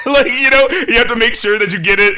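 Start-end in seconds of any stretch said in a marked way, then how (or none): none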